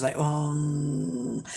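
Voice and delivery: low zombie groan; zombie noise. Pretend. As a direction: moan like a shambling zombie. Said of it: "that was my zombie noise".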